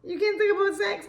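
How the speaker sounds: high-pitched mock outrage; scandalized Christians. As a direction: mimic a shocked, prudish objector in a high voice. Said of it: "all these Christians and everybody".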